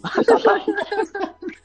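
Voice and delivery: sudden high-pitched burst of laughter. Real, not acted. Laughs at the prison story. (高声笑)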